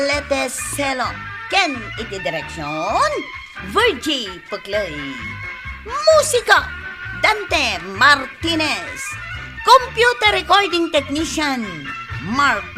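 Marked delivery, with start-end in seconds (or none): none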